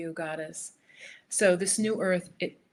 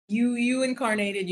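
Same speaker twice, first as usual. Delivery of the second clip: ordinary talk, nothing marked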